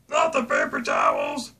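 low, slow, loud cry of dismay; horrified shout. Pretend. Dad shouting at the empty store shelf, loud dismay. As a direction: bellow it like disaster struck. Shock at paper towels gone.

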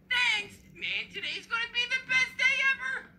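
put-on high, loud, gleeful child voice; thrilled kid brother. Billy leaves for school in pajamas, thrilled. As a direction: crow it in an excited kid voice.

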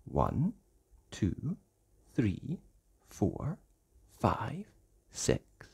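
soft counting building suspense; hushed count. Acted. Reader counting ducks softly to a child. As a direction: count quietly, building tension.

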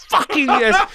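high, loud, jubilant outburst; exultant cheer. Retold or imitated. Runaway learns the man was trouble too, exultant. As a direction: celebrate an unexpected ally at full pitch. Acted triumph at unexpected sympathy.

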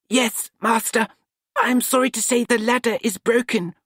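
put-on dutiful young helper speaking up; young apprentice Nut. A narrator's reading.

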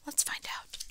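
hushed suspense whisper. Pretend. Reader whispering to children before the reveal.

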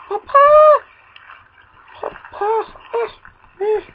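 put-on high shrill childish call; kid calling papa. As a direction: wail like a small kid.

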